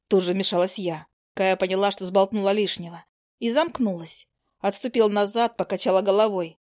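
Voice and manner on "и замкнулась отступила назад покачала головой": ordinary delivery, nothing marked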